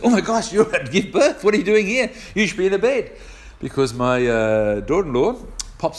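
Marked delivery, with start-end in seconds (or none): 0.0-3.1 high, louder mock alarm; startled nurse. Nurse realising the mother is about to give birth, startled. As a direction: imitate a nurse's shocked scolding, pitched well up. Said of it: "her first comment says".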